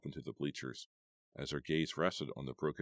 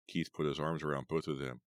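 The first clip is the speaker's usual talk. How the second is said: ordinary delivery, nothing marked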